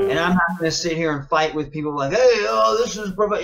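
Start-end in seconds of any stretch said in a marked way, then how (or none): 2.1-3.4 higher, louder mock protest; mock complainers. Wrestler mimicking people who'd fight him over wrestling, mock protest. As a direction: put on a loud, high objecting voice.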